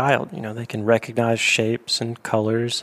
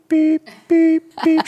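very high, piercing beep like a reversing truck; backup beep imitation; 4 dB louder, slower than usual. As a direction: mimic the parrot's backup beep. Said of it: "this, this backup sound".

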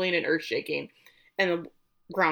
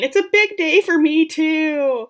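high, much louder self-pitying shriek; mock screaming Misty; 9 semitones higher, 10 dB louder than usual. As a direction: scream it like the bride's spoiled sister. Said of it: "Misty screaming".

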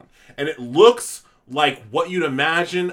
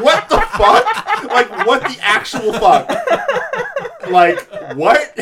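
high, loud, slow disbelieving laugh-shout; incredulous outburst; 9 dB louder, slower than usual. Retold or imitated. Retelling his reaction to carbonara critics, loud laughing disbelief. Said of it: "And I'm like".

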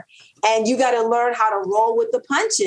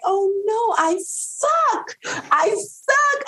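mock despair; 13 semitones higher, much slower than usual. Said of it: "I'm like".

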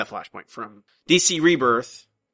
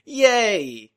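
higher-pitched cheering "yay"; demonstrated cheer; 8 semitones higher, 3 dB louder than usual. Shown as a demonstration. Host shows co-host the expected response, cheering. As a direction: give a drawn-out mock cheer. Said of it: "You're supposed to go".

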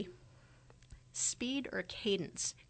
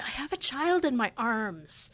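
a touch louder, mildly defensive retort; 3 semitones higher, 5 dB louder than usual. Retold or imitated. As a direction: push back a little, lightly voiced. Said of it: "very defensively responded".

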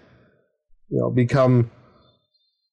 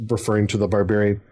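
ordinary delivery

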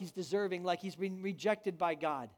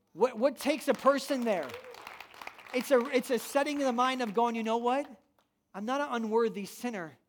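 raised, ringing pulpit voice; preaching at full force; 3 semitones higher, 3 dB louder than usual. Real, not acted. Pastor rousing the congregation, loud preaching. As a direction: lift the whole room with it.

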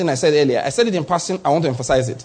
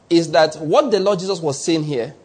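normal talking voice

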